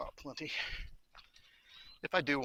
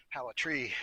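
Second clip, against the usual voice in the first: ordinary delivery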